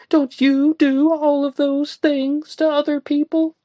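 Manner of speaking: high young woman's voice, lightly acted; Joanna's voice. A narrator's reading.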